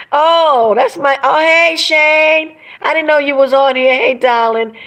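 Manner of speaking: light up at seeing a friend; excited greeting. Real, not acted. Spots a friend in chat.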